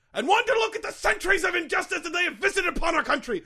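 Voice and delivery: loud, high ranting outrage; impassioned rant. Acted. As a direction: rise into a furious tirade. Mascot's joke turns into rant.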